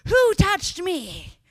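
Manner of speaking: loud, high startled cry, slowing on the question; high alarmed shout then a slow searching question; startled call. Acted. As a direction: call out as if someone just grabbed your cloak. Jesus voiced in retold story.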